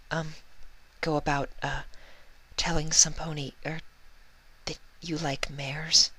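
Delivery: Fluttershy confessing shyly to Vinyl, embarrassed. Acted. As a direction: ask it shyly, stumbling. Shy Fluttershy dialogue.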